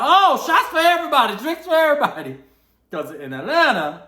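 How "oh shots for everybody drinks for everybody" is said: excited treating. Retold or imitated. Treating everyone to shots.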